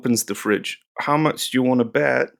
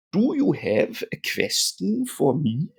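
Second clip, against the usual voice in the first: slow, higher put-on German accent; 6 semitones higher than usual. Teacher playfully repeats the app's question, mock German accent. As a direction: say "question" as "kvestion", German-style.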